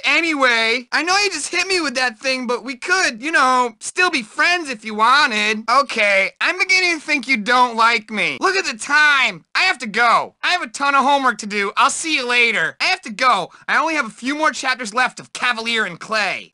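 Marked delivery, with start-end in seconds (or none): none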